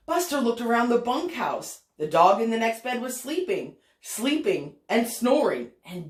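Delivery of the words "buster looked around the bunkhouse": plain reading voice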